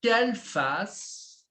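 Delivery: palavras bem alongadas; modelo pausado. Shown as a demonstration. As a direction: estique devagar para o aluno repetir. Professor dá modelo lento ao aluno. (modelo lento de professor)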